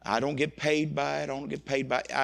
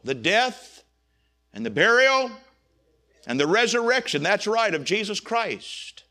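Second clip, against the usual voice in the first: louder, higher fervent sermon climax; preaching at full force; 5 semitones higher, 5 dB louder than usual. Acted. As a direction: drive the gospel home to the congregation.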